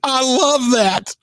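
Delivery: high, slow, delighted exclamation; real delight. Real, not acted. Host reacting to a toddler testimonial, delighted.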